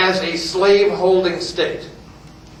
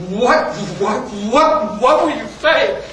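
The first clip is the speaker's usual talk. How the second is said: spluttering mock outrage, pitched up; raised, incredulous angry officer; apoplectic colonel; 4 semitones higher, 3 dB louder, slower than usual. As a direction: stammer in acted disbelief and fury. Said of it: "colonel's face turns red as a, he goes nuts".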